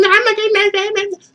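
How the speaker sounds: squeaky high voice for a friend turning him down; high mock girl voice. Friend brushing off his Xbox invite, squeaky high and dismissive. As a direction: pitch it way up, dismissive.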